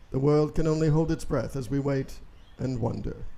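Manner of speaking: ordinary talk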